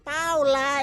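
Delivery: mock yell from the gate, held back; retold shout. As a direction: imitate a man hollering for someone. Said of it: "Ele gritou".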